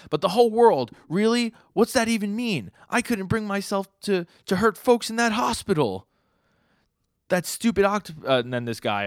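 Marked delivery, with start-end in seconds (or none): 0.1-6.1 high, loud, incredulous voice; disbelieving, pleading with the crowd; voicing the octopus hero. Octopus hero questioning the crowd, high incredulous voice. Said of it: "but he goes". 7.3-8.3 voicing spider guy. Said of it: "the spider guy's like".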